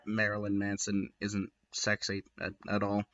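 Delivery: quiet aside. Real, not acted. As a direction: drop under your breath for a dig.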